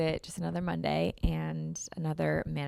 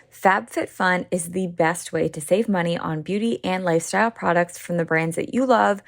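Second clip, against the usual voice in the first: punchy, louder podcast sponsor read; 6 dB louder than usual. Acted. Host pitching a subscription box to listeners, upbeat ad read. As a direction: sell it with emphatic, projected energy.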